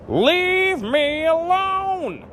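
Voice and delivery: drawn-out, very high, loud shout. A narrator's reading. Old woman yelling back at her family as she leaves, drawn-out shout. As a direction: stretch it out in a huge high yell. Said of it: "the old woman shouted".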